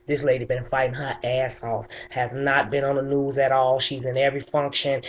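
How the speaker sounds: ordinary delivery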